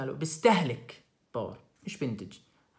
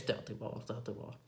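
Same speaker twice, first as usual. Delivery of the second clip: تعطي باور whispered under the breath, much softer; 6 semitones lower, 11 dB quieter than usual. Real, not acted. Teacher quietly answering own question, whispered. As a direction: murmur the answer to yourself.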